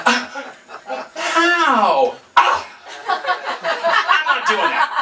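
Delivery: high, slow nervous whine; mock panic. New guy panicking about poking sharks, high and whiny. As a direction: panic comically at the idea.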